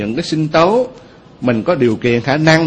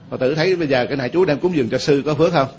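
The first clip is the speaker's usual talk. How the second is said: normal talking voice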